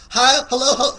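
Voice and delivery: high, loud hello; loud excited hello. Real, not acted. The host greets his mother joining the stream, loud and high. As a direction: call out hello with delight.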